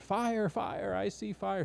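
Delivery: mock frantic scream. Woman in the boxcar warning of fire, mock frantic cry. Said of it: "screaming at the top of her lungs".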